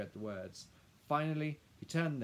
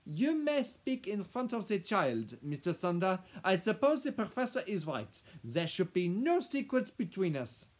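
imperious Madame; 11 semitones higher, 10 dB louder than usual. Acted. Madame telling the lawyer to speak, imperious. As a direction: give permission as a command.

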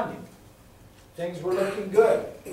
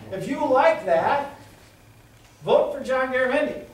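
sarcastic; 6 semitones higher, 5 dB louder than usual. Acted. Sarcastic campaign taunt.

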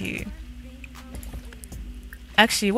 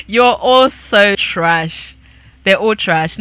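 slow scornful drawl; 6 dB louder, much slower than usual. Real, not acted. Mocking failed dives.

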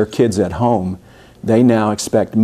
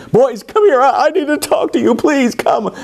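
high, pleading mock Pharaoh voice; 13 semitones higher than usual. Pharaoh calls Moses and Aaron back, pleading high. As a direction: beg them to come back, much higher.

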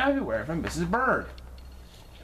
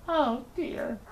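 dismayed; 11 semitones higher than usual. A narrator's reading. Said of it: "he cried".

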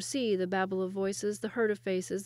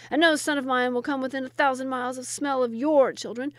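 louder, higher indignant rant; 4 semitones higher, 7 dB louder than usual. A narrator's reading. First wazir vowing against his rival, ranting. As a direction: spit out the refusal emphatically. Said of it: "ranted the first wazir".

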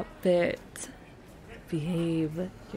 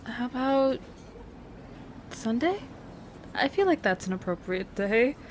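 usual speaking voice

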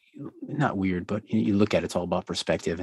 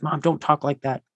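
put-on mildly upset kid's protest, higher pitched; upset child, retold; 7 semitones higher, 4 dB louder than usual. Daughter objecting to mom's talk of dying, mock upset. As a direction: lightly act out a distressed child's plea. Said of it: "I'd get so upset".

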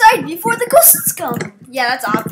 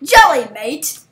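mock British accent, lower; imitated British accent; 5 semitones lower, slower than usual. Teasing a friend about his accent, mock British. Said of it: "I've heard his real accent".

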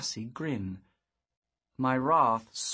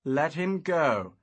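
slow firm command; 4 semitones higher, 6 dB louder, slower than usual. A narrator's reading. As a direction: order him to let go. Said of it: "I commanded".

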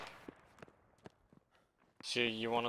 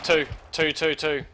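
quick, raised, repeated warning; urgent callout; 5 semitones higher, 6 dB louder than usual. Real, not acted. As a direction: bark out the warning.

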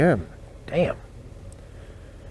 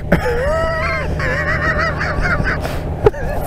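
high, squealing real laugh; helpless laughter. Real, not acted. Storyteller cracking up over his friend's heckle, high laughter. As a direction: crack up at your own story.

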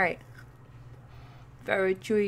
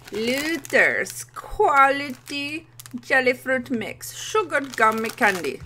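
grand announcement; 6 semitones higher, 8 dB louder, slower than usual. Real, not acted. Announcing candy name grandly.

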